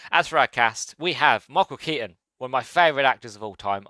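ordinary talk, nothing marked

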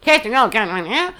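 slow, drawn-out Mid-Atlantic accent; mock the old-movie Mid-Atlantic accent, stretching it out. Shown as a demonstration. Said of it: "δεν μπορώ να τα ακούω αυτή την προφορά".

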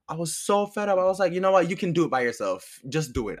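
snapping back at her as it's retold; fed-up outburst. Retold blowup at the relative.

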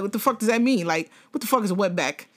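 normal talking voice

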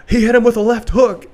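hammed-up play-by-play call; mock commentator. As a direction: call the punch like an excited commentator. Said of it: "He would always mess up, like".

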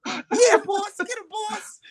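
very high, crying-out squeal; squealing cheer. Pretend. Co-host cheering in a very high squeal. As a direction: shriek it like a cartoon fan.

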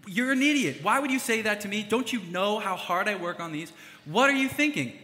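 high, loud mock-angry rant at his dad; hammed-up fury, yelling at Dad; angry outburst. Son snapping back at his dad over a sarcastic remark, mock-angry yell. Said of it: "I could respond out of my anger and emotion".

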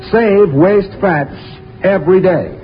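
usual speaking voice

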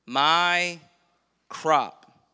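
loud, possessive "my crop"; rich fool's claim. Acted. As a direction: stake the claim loudly on "my crop". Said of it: "refers to his bumper crop".

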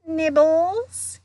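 high, sing-out sweet offer; falsely sweet. A narrator's reading. Poltergeist offering them mouldy peanuts, sweetly. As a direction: lilt upward, sugary. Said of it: "he said sweetly".

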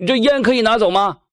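loud call to an unseen owner; loud question. Acted. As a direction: shout the question across the room. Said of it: "他又大声问了".

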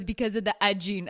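put-on loud French-accented "agine" with the H dropped; heavy French accent. Bartender explaining his advice to customers, heavy French accent. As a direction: imitate the bartender's hard-to-follow French English. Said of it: "very heavy accent".

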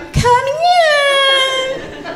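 high, sing-out invitation; sweetly oblivious high holler, hammed-up; mock casual call-out. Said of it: "I wouldn't go".

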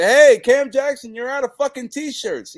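high, loud slow heckle; heckling fan. Retold or imitated. Fan heckles him in public, loud high heckle. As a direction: call out across the crowd. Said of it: "and say".

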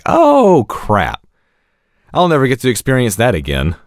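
high squeak of dismay on "Oh"; a mock-dismayed gasp of realization; dismayed "oh crap". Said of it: "being like".

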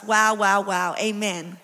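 normal talking voice

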